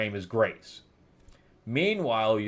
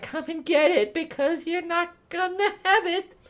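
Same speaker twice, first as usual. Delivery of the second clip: much higher mocking sorcerer voice; taunting villain. Kulan Gath taunting the demon, high mocking voice. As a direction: taunt him in a high put-on voice. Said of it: "And he's like".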